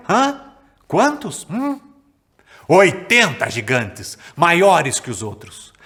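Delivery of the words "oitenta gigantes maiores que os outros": slow, loud, drawn-out announcement of the giants; dramatic slow reveal. A narrator's reading. Storyteller wowing the kids with the giants, slow and loud. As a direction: stretch each word to make the giants feel huge.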